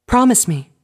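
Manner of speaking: loud, high, insistent begging; urgent plea. Acted. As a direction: demand the promise. Fluttershy insists.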